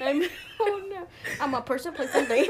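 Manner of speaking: high, giggly and loud; laughing. Real, not acted. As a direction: joke along while laughing. Both laughing at joke.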